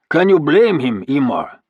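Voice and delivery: loud, high mocking call; beefy warrior shouting a jeer. A narrator's reading. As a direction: heckle from the doorway. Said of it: "shouted a beefy warrior".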